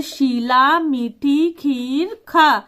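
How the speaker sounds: put-on each word spaced out slowly for a child; slow dictation. Shown as a demonstration. Teacher dictating a sample sentence, slowly. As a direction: read it like dictating to a class. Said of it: "छोटे से sentence, वाक्य बता".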